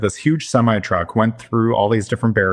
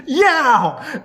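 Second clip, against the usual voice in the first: mock defiant yell; 14 semitones higher than usual. Friend answers the angry vandal.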